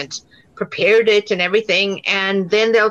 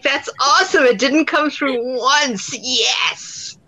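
higher-pitched gleeful triumph; retold delight; 6 semitones higher, slower than usual. Actress learns her accent went unnoticed, retold delight. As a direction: celebrate it, stretching out the last words.

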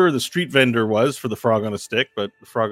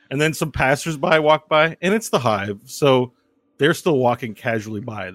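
ordinary talk, nothing marked

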